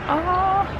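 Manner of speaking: ordinary delivery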